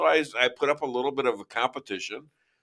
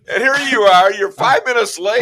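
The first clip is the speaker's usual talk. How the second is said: loud mock scold, higher and louder; mock scolding shout; 7 semitones higher, 8 dB louder than usual. Real, not acted. Host mock scolds late guest, loud and high. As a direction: bust his chops about being late, loud.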